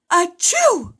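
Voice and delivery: a loud high sneeze bursting out; huge sneeze. A narrator's reading. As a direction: sneeze hard mid-sentence. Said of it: "Bless you".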